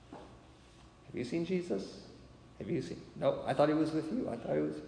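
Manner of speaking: softly acted anxious parents; quiet, puzzled back-and-forth, lightly acted; hushed worried asking. Parents asking each other about missing Jesus, hushed worry.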